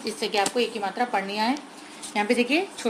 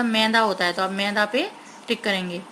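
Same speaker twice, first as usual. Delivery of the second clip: ordinary talk, nothing marked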